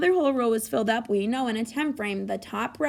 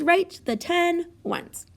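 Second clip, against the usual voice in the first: slow reading; 6 semitones higher, much slower than usual. Shown as a demonstration. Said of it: "teacher reads directions slowly".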